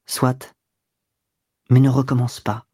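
restrained, cold concession; cold murmur. A narrator's reading. Mistress grudgingly forgiving her kneeling lover, cold. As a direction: give in coolly, understated. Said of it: "d'une voix froide".

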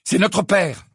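high loud protest; indignant outburst. A narrator's reading. Fred protests to Sirius, high and loud. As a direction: throw it back at him.

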